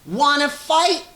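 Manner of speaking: high, loud challenge from a tiny bug; grouchy ladybug. A narrator's reading. Ladybug squaring up to the rhinoceros, high and loud. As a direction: squeak it out like a picking-a-fight bug. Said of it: "said the grouchy ladybug".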